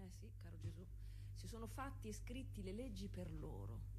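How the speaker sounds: normal talking voice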